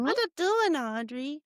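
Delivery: high cooing voice for a pet; pet talk. Real, not acted. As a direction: coo at the dog. Greeting the dog in pet talk.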